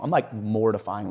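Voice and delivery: usual speaking voice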